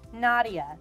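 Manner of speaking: ordinary delivery, nothing marked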